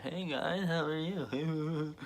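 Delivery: soft, high, loopy greeting; groggy from anesthesia. Retold or imitated. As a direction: imitate waking up loopy on happy gas. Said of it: "you wake up and you're like".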